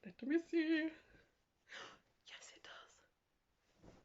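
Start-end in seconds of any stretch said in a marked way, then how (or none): 2.3-3.9 slow hushed whisper of delight; awed whisper. Real, not acted. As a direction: breathe it out in wonder. Checks other dress, gasps.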